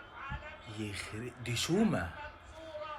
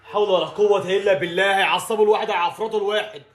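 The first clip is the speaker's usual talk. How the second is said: louder fed-up grumbling; exasperated rant; 4 semitones higher, 6 dB louder than usual. Real, not acted. Fan fed up with his team, exasperated rant. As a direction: at the end of his rope.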